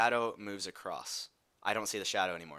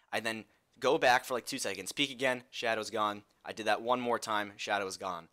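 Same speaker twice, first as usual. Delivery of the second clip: ordinary talk, nothing marked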